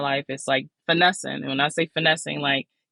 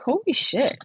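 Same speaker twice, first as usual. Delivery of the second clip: stunned realization; 11 semitones higher than usual. Retold or imitated. Sudden realization, high far voice.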